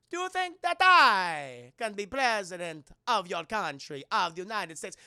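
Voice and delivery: put-on Italian villain accent. Red Skull gloating over fallen Captain America, slow put-on Italian accent. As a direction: sneer it slowly in a cartoon Italian accent.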